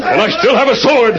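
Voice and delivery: high, ringing defiance; defiant call. Acted. Masked man faces the crowd with a sword, defiant call. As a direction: call it out boldly mid-fight.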